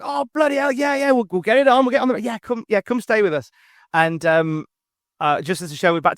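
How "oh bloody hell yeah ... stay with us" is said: voicing his dad, higher and eager; dad thrilled his son will visit, higher voice; excited dad. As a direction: play the delighted father saying yes. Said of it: "And Dad was like".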